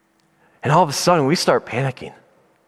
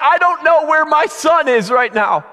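high, urgent, desperate plea; crying out to God; 13 semitones higher, 4 dB louder than usual. Retold or imitated. Panicked father praying for his missing son, crying out. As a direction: pile up frantic pleas at the top of your range. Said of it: "yelling and crying out to God".

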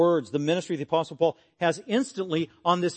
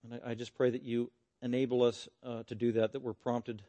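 prayer; 2 semitones lower than usual. Real, not acted. Closing prayer to the congregation.

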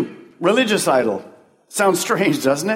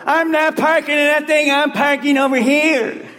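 high, whiny mock complaint from a grumbling parker; grouchy churchgoer; 10 semitones higher than usual. Pastor mocking a congregant who refuses the assigned parking. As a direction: mimic a stubborn parker refusing to budge. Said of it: "and they go".

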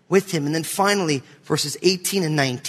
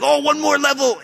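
put-on whiny kid; 8 semitones higher than usual. Son whining to father.

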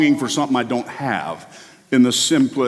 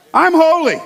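much higher and louder, "I'm" shot up; triumphant cry; 14 semitones higher, 6 dB louder than usual. Real, not acted. Preacher proclaiming his identity, high triumphant cry. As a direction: proclaim it like a victory.

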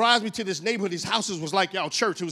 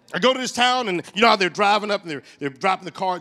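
ordinary talk

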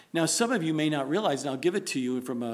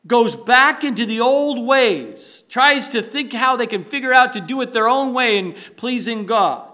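raised, louder preaching voice, driving the point; preaching at full force; 6 semitones higher, 8 dB louder than usual. Acted. Pastor urging the congregation to endure trials, fervent preaching. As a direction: push it like a pulpit sermon building steam.

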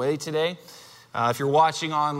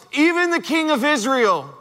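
higher, raised cry; crowd yell; 8 semitones higher, 3 dB louder than usual. Retold or imitated. Preacher voicing the crowd yelling the king's title, raised cry. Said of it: "when the crowd yells".

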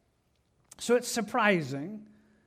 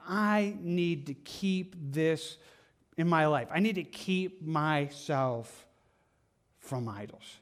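deliberate resolve; 2 semitones lower, slower than usual. Acted. Believer telling himself to keep from idols, deliberate. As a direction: weigh each word, then drop away. Acted resolve, slowly weighed words.

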